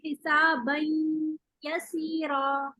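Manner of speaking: हिसाबन यसीरा with the नून carried long through the nose into यसीरा; very slow, hum the nasal between हिसाबन and यसीरा; teacher models हिसाबन यसीरा with a long nasal ghunna; nasal ghunna demo. Shown as a demonstration. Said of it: "एक दफा तो हम नाक में आवाज लेके जा रहे हैं".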